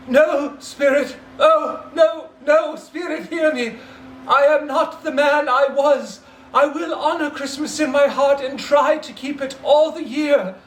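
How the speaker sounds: high, loud, frantic begging; desperate pleading. Acted. Scrooge begging the spirit at his own grave, desperate. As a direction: plead for your life, voice cracking high.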